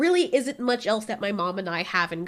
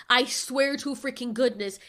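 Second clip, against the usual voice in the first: ordinary talk, nothing marked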